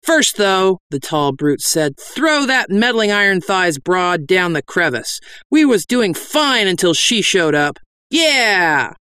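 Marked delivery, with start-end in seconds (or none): 0.0-0.8 lightly voiced brute, pitched up; a hint of a bossy bandit. Brute orders the gang about. 2.0-7.8 raised, bossy bandit voice; brute bandit. Acted. 8.1-8.9 high bandit shout of agreement; mob cheer. Acted.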